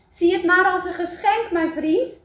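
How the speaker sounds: Eli's voice. Acted. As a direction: speak up gently as the maker. Eli answers Wout in story. (licht gespeelde vriendelijke maker)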